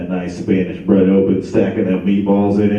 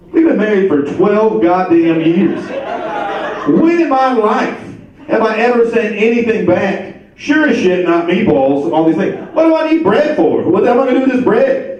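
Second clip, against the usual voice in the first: outraged husband shouting over lost meatballs; furious rant; 10 semitones higher than usual. Retold or imitated. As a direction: rant at the wife, pitch climbing with each line. Retold furious rant at wife.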